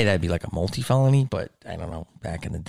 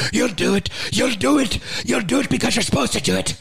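high-pitched scolding nun, half Irish; stern Irish nun; 9 semitones higher than usual. Retold or imitated. Nun answers a questioning pupil, stern mock Irish scolding. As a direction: imitate a cross old-school nun laying down the law. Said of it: "like, a half Irish accent".

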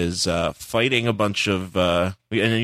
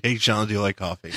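laughing quote. Retold or imitated. Repeating the joke while laughing.

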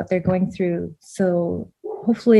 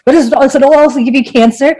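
laughing mock question; 7 semitones higher, 10 dB louder, faster than usual.

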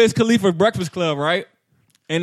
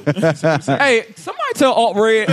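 laughing; 3 semitones higher, 3 dB louder, slower than usual. Real, not acted. Laughing at the joke.

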